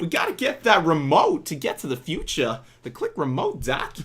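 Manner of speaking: bad celebrity voice doing a film line; Christopher Walken. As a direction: badly mimic a famous actor's delivery. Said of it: "Now he's Christopher Walken".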